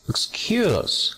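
"excuse" stressed on CUSE; slow "excuse", leaning on the second syllable. Shown as a demonstration. Teacher modelling word stress, "excuse" accented on second syllable. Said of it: "segunda sílaba".

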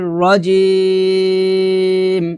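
slow, melodic tajweed chant with long held vowels; Quran recitation. Real, not acted. Teacher opening a Surah Yasin lesson, slow Quran recitation. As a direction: recite it slowly, drawing out each long syllable.

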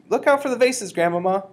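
high, loud alarm; high-pitched yell of warning; warning shout. A narrator's reading. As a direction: call out an urgent warning. Warning grandmother waving her stick.

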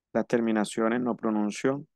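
ordinary delivery

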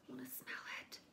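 soft unvoiced whisper. Real, not acted. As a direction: breathe it to yourself. About to sniff product.

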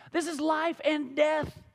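each word laid down slowly and deliberately; slow down and weigh every word; slow emphatic. Real, not acted. Preacher to congregation, slow weighty emphasis. Preacher hammering the stakes home.

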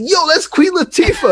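very high, yelled call; yelling across the street. Retold or imitated. Yelling to friends across the street, high call. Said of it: "I'm yelling across the street".

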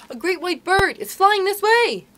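high, loud cry of wonder; excited announcement. A narrator's reading. Nibs spotting a bird for the boys, excited cry. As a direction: call out the sighting, drawing out the end.

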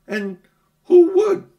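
put-on high, loud girlish retort; young girl snapping back, high and loud; girl's voice. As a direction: pitch it up as young Polly. Polly voiced in story.

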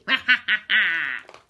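loud villainous "ha ha ha ha"; hearty mock laugh of two crooks; gleeful laugh. A narrator's reading. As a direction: cackle with glee, loudly. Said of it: "they laughed with glee".

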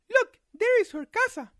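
put-on high girl's voice; little girl voice.

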